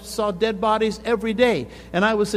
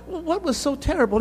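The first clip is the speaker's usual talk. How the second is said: surprised onlooker; 8 semitones higher than usual. Retold or imitated. People question revived man.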